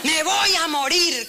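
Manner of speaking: high, drawn-out mock wail; theatrical woe, played for laughs; melodramatic despair. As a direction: ham up the death wish, slowly. Re-enacting Elijah's dramatic complaint.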